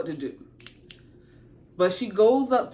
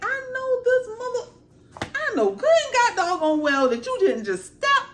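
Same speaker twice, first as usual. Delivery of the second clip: a mother whose egg was stepped on; hammed-up angry mom; mama dragon fury; 9 semitones higher than usual. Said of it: "she over there looking like".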